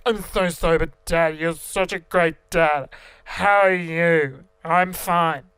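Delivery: put-on loud, dragged-out drunken gushing; drunk feigning sober. Drunk self insisting to dad they are sober, loud slurring. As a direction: slur it slowly and too loud. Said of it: "That was me trying to be".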